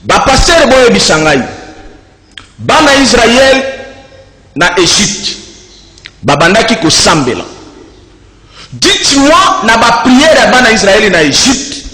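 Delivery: emphatic, declamatory delivery with weighty pauses; forceful preaching. Real, not acted. Preacher rebuking pastors over celebrations in Egypt, forceful preaching.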